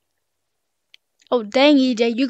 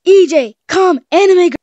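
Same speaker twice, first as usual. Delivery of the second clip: mock anime girl call; 6 semitones higher, 8 dB louder than usual. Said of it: "I got a really good one".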